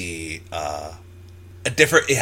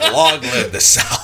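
slow, loud mock Southern drawl; Southern accent; 2 semitones higher, 7 dB louder than usual. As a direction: proclaim it louder in a hammed-up Southern accent. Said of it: "I'm just gonna do my southern".

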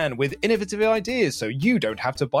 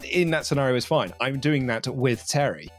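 ordinary delivery

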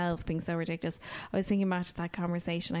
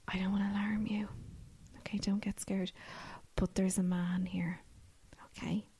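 whispered, softer warning; secretive whisper; 6 dB quieter than usual. Retold or imitated. Secretary warning the teacher about a stranger, whispered. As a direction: whisper it so the man won't overhear.

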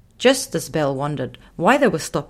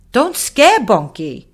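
higher, louder, slow lion rebuke; scolding little lion; 6 semitones higher, 7 dB louder, slower than usual. Acted. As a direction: tell him off gently. Raga scolds Muffin.

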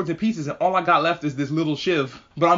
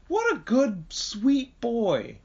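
cooing fondly; 7 semitones higher, much slower than usual. Real, not acted. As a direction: coo like over a puppy. Cooing over Tanjiro.

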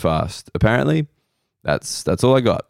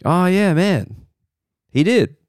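high, put-on "oh yeah, man"; sarcastic agreement; 9 semitones higher than usual. Comic mocking his own reaction to the cyclist, high put-on voice. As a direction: play it as a mocking self-rebuke.

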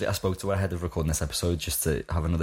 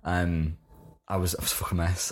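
ordinary talk, nothing marked

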